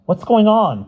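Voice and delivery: loud alarmed cry. A narrator's reading. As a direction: call across the room, alarmed. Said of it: "cried Bruno".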